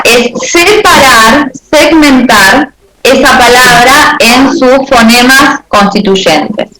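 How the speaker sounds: each word laid down one at a time; slow, deliberate definition. Shown as a demonstration. Teacher defining phonemes for students, slow and deliberate. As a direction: spell it out slowly for the class. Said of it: "teacher slows to define".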